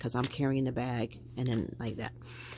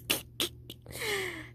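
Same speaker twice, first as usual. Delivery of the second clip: soft, high-pitched laugh; 6 dB quieter than usual. Real, not acted. Vlogger laughing at sounding like another creator, high soft laugh.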